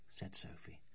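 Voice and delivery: ordinary delivery, nothing marked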